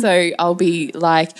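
normal talking voice

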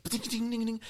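high tinkling glass noise; high, rapid "ding" sound effect; imitated breaking glass. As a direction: mimic shards tinkling down.

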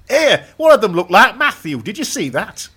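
chatty Yorkshire commando in a game; hammed-up Yorkshire accent. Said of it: "They've all got Yorkshire accents".

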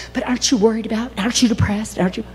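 rapid, pestering questions; mock concerned questioner. Questioner pestering the believer about worry, mock concern. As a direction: fire off nagging questions.

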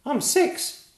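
put-on proud small boy answering; piping kid knight; little knight. A narrator's reading. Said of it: "said Tiny".

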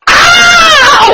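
sudden very high shrieking yelp; Kevin Hart scream. Retold or imitated. Retelling brother's scare to a friend, mock shrill scream. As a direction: imitate a panicked high-pitched shriek. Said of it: "screaming like Kevin Hart".

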